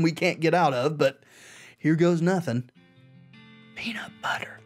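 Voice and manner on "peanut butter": whispered password, no voice. Acted. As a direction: breathe the words out secretly. Saying magic password to cow.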